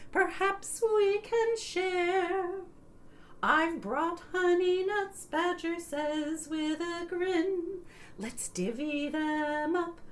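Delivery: put-on lilting sing-song read to kids; sing-song picture book reading; storybook read-aloud. A narrator's reading. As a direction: read it like bedtime story time, half singing.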